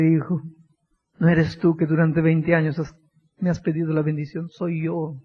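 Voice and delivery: low, soft, tender reply; soft, low answer from Christ; gentle Christ voice. Acted. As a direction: speak to him like a loving father.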